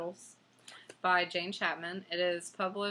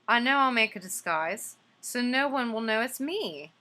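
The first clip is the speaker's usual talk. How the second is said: higher and louder, pleased with himself; lightly voiced scheming bear; bear's bright idea; 6 semitones higher, 6 dB louder than usual. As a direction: pitch up with a clever plan. Bear plans disguise, read aloud.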